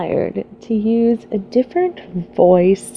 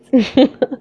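short burst of laughter; brief amused laugh; real laugh; 5 semitones higher, 5 dB louder than usual. Real, not acted. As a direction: crack up at your own Southern accent. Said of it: "without laughing".

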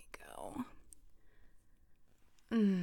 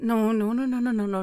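loud teasing no; 2 semitones higher, 8 dB louder, faster than usual. Acted. Stopping partner closing eyes.

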